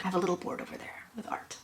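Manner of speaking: fast whispered aside. Real, not acted. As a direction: rush it under your breath. Quick aside about board.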